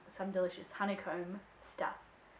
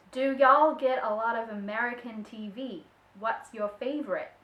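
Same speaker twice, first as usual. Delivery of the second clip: put-on American accent, a bit louder; 5 dB louder than usual. Shown as a demonstration. Vlogger reads a viewer's question in her best American accent. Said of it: "in my best American accent".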